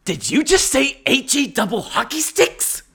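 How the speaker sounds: high, slow, prissy teacher voice; mock shocked teacher; prim teacher. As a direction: play the scandalised junior high teacher. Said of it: "the teacher went".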